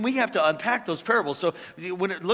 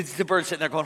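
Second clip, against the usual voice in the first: ordinary talk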